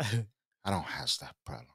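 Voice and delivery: put-on low, slow, soft Scarface accent; Tony Montana boast, low and slow in a Scarface accent. Host retells taunting Russians in a low, slow Scarface accent. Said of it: "getting good at the Scarface accent".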